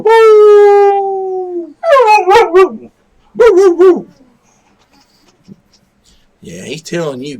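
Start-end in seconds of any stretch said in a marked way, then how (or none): 0.0-4.1 loud high howl breaking into short woofs; imitated howling; dog howl. Shown as a demonstration.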